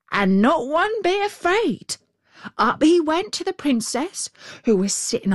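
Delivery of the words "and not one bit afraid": crow. A narrator's reading. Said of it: "said the Crow".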